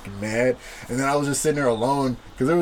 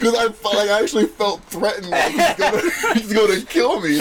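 real laughter through raised pitch; laughing; 6 semitones higher than usual. Real, not acted. Teller admitting he felt threatened, laughing. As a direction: tell it higher, breaking into laughs. Laughing while telling the story.

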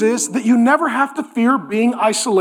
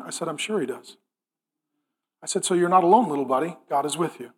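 tender reassurance; 6 semitones lower, 6 dB quieter than usual. Retold or imitated. Dad comforting scared son.

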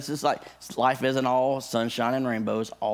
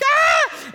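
piercing high shriek, much louder and higher; little girl scream; 7 dB louder than usual. Retold or imitated. Pastor admitting how he screamed at the spider, high girlish scream. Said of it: "I screamed like a little girl".

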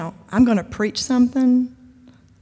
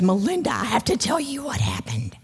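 conspiratorial whisper; 2 semitones higher than usual. Retold or imitated. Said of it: "she goes".

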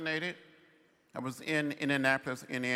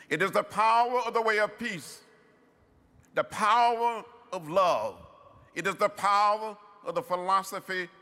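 louder and higher, preaching the power of love; rising oratorical fervor; 5 semitones higher, 6 dB louder than usual. Real, not acted. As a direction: lift it like a sermon peak. Orator building to climax.

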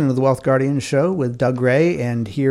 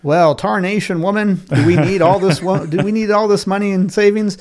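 a hammed-up gold-rush codger; old prospector; 6 semitones higher than usual. Answers in old-timer voice.

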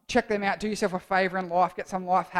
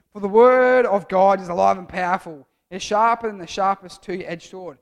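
louder, declaiming the verse; scripture reading; 7 dB louder than usual. Acted. Leader reading Hebrews to the youth group, declaimed. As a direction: read it out with weight.

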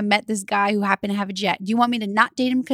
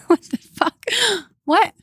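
laughing; 8 semitones higher, slower than usual. Real, not acted. Laughing at a cheese joke.

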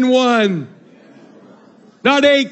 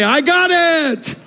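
slow celebratory shout; 4 semitones higher, much slower than usual. Shown as a demonstration. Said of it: "slap five and say".

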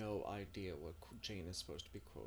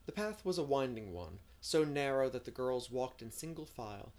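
measured audiobook storytelling; story narration; 4 semitones higher, 8 dB louder than usual. A narrator's reading. Narrator setting the scene of a spring picnic day, storytelling. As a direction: read the scene aloud, shaping each phrase.

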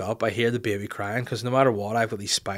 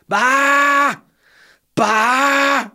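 loud, high sustained bleat; blaring imitation of a baby's shout; sheep-like baby bleat; 10 dB louder than usual.

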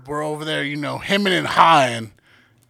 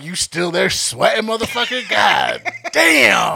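mock taunting shout; 6 semitones higher, 7 dB louder, slower than usual. Imitating friends mocking her loudly.

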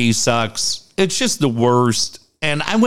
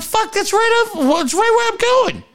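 pitched way up, panicked; alarmed realization. Retold or imitated. Realizing the snow is right where he's driving. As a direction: squeak it out in mock panic. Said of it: "I'm like".

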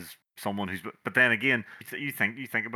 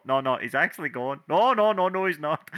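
mock protest; 5 semitones higher, 4 dB louder than usual. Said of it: "everyone else was all".

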